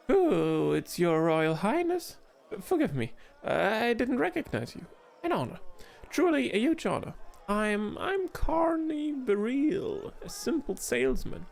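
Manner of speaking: a twitchy little gnome caught off guard; nervous gnome voice. Acted. Gnome merchant startled by the queen, nervous and high.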